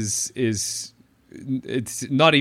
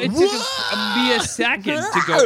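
shouting. Real, not acted. Shouting at the transition.

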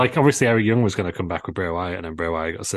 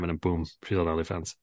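normal talking voice

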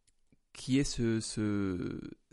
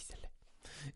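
whispering; 4 semitones higher, 18 dB quieter than usual. Pretend. Réplique taquine chuchotée.

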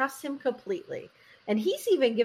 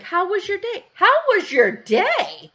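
high, singsong creepy greeting, growing louder and slower; fake-sweet and menacing, pitched way up; creepy smiling husband; 8 semitones higher, 6 dB louder than usual. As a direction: imitate an unnervingly cheery Jack Nicholson type. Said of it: "smiling at her real creepy".

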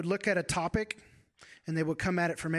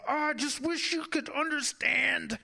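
much higher, yearning cry of the heart; aching plea; 10 semitones higher, slower than usual. Retold or imitated. Husband longing for his wife to understand, pleading high. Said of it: "everything in me is saying".

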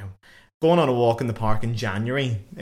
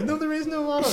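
very high mock child voice; thirteen-year-old boy. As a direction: imitate a young lad in a squeaky pitch. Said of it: "I'm thirteen years old".

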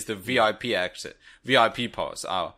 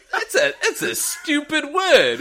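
high, laughing mock outrage at a word; mock disbelief; 11 semitones higher, 4 dB louder, slower than usual. Retelling a first reaction to Vince, mock-outraged disbelief. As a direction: scoff through laughter, pitched way up. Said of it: "And I thought".

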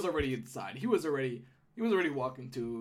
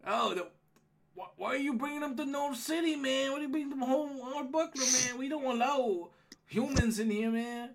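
higher, quick-talking indignant gnome guard; gatekeeper gnome, complaining; 11 semitones higher, 3 dB louder than usual. Acted. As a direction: object like a put-out gnome gatekeeper.

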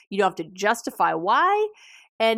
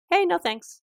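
light, much higher, casual brush-off; breezy high no thanks; 9 semitones higher than usual. Shown as a demonstration. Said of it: "practice just saying simply".

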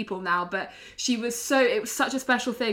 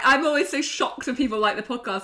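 ordinary talk

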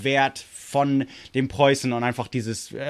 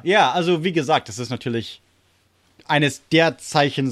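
usual speaking voice

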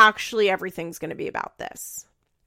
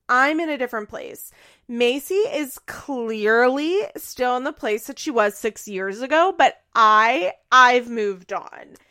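loud, drawn-out superior insistence; mock self-righteous; 3 semitones higher, 7 dB louder than usual. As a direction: stretch the words in exaggerated haughtiness. Continued mocking impression of Farrah.

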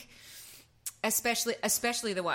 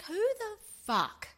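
much higher, slow incredulous; mock outrage; 11 semitones higher, much slower than usual. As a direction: stretch it out in shocked disbelief. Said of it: "But I was like".

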